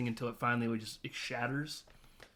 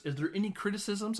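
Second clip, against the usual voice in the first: higher, mildly flowery mock question; sarcastic teacher; 8 semitones higher than usual. Teacher asking class to critique poem, mock sarcastic. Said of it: "he's doing it very sarcastically".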